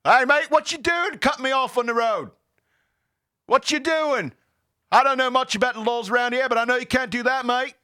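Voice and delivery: put-on high, loud Australian accent; hammed-up Australian accent; fake Australian accent. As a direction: confront another driver in an Australian accent. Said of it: "I also like doing, like, an accent".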